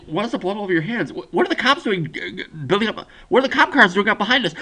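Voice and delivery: high, alarmed getaway questions; rising alarm, hammed up to a near cry; mock panic. Driver seeing blood and cop cars, mock panic.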